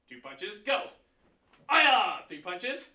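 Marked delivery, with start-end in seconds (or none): 1.7-2.2 sharp, loud martial arts shout; loud kiai. Shown as a demonstration. Instructor leads the class through the form: loud kiai. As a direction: snap the shout out with the punch. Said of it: "you key up".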